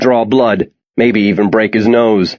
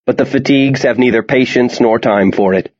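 ordinary delivery, nothing marked

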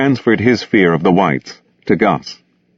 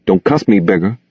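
plain reading voice

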